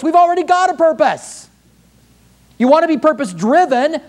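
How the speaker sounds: impassioned preaching. Real, not acted. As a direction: drive the rebuke home to the church. Preaches with rising force.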